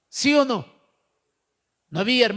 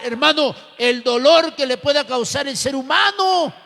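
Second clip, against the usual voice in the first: loud, rising sermon cry; full-force preaching; 3 semitones higher, 6 dB louder than usual. Acted. Pastor exhorting the congregation to hold onto God, loud and building.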